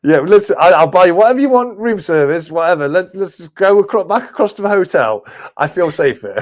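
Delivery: high-pitched, hurried-sounding mock desperation; urgent pleading. Traveller begging friends back to the hotel, high and urgent. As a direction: plead high, playing the panicked bargainer.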